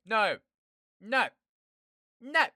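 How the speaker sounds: emphatic loud no. Real, not acted. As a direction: shut it down hard. Loud refusal.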